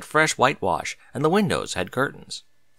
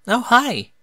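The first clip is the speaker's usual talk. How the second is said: startled high gasped greeting; surprised gasp; 11 semitones higher, 4 dB louder than usual. A narrator's reading. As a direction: caught off guard at the door. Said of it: "gasped Derpy in surprise".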